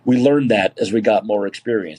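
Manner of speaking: usual speaking voice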